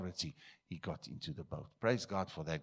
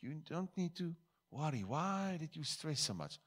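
higher, soothing reassurance to frightened disciples; gently calm scared friends, pitched up; calming, encouraging; 8 semitones higher than usual. Acted. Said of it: "calming them and encouraging them".

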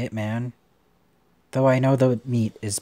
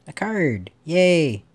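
high, louder cheer on finding something; excited; 6 semitones higher, 7 dB louder, slower than usual. Real, not acted. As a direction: cheer the little discovery. Finds a card in the game.

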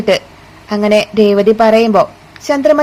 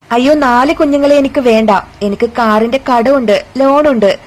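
usual speaking voice

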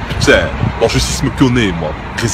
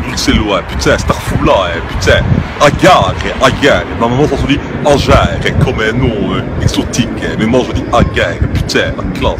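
put-on loud, thick Brussels street accent; a blocked-nose Brussels thug voice; 5 semitones lower, 6 dB louder than usual. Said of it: "c'est quoi cet accent? Il a le nez bouché".

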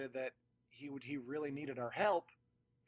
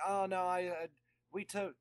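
put-on high, loud cranky old man; Max voice; 6 semitones higher, 5 dB louder than usual. Retelling the actor's brush-off in his cranky high voice. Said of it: "try to do the Max voice".

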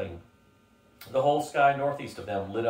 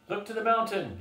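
yelling; 10 semitones higher than usual. A narrator's reading. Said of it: "yelled a voice".

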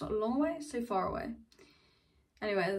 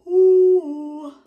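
loud ooh; 8 semitones higher, 18 dB louder than usual. Real, not acted. Sudden loud exclamation.

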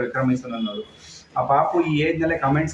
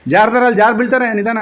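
calls out louder and higher in warning; 6 semitones higher, 8 dB louder than usual. Real, not acted. Father warning the kids they will slip, loud call. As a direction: shout a warning across the room.

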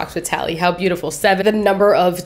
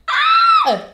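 a high, sharp yelp of a shriek; sudden shriek. Real, not acted. Overwhelmed host, mid-emotion on camera, shrieks suddenly. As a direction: let out one sudden high cry. Overwhelmed outburst while filming intro.

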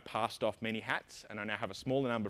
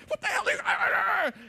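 high, slow shouted outburst; mock CEO shout; 8 dB louder, slower than usual. Imitating the CEO at four in the morning, a hammed-up shout. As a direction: bellow it like a shocked boss. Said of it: "the C E O was like".

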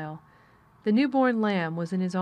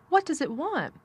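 higher, eagerly curious question; excited cry; 8 semitones higher than usual. A narrator's reading. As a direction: cry out, unable to hold back. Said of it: "cried Colin".

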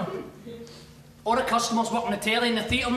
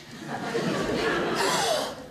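mock gasp; 3 dB quieter than usual. Mock posh boss reaction.

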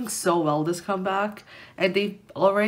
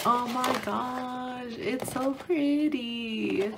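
awed; 5 semitones higher, 3 dB quieter, much slower than usual. Real, not acted. Unboxing the poster, awed.

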